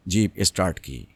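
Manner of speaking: plain reading voice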